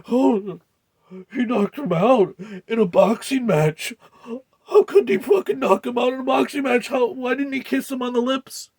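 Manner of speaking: high, loud mocking shriek of outrage; mock outraged crowd. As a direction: shriek it like a scandalized fan. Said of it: "And everybody's like".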